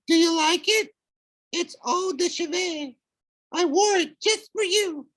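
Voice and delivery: very high, coy falsetto; flirty falsetto. Acted.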